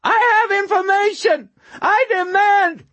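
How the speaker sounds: thin, reedy, very high screech; frantic high-pitched demand; screeching homeless man. A narrator's reading. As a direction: screech it loudly in a reedy falsetto. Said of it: "screeched in a thin, reedy voice".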